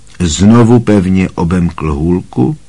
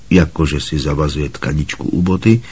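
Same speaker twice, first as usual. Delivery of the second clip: usual speaking voice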